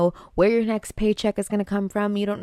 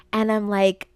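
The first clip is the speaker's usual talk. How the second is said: usual speaking voice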